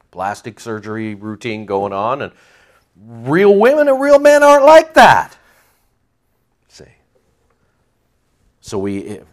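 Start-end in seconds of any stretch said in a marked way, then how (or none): 3.0-5.4 high, loud preaching burst; rising to a loud exclaim; emphatic. Real, not acted.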